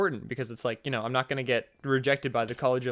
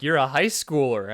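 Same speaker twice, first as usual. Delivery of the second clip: ordinary delivery, nothing marked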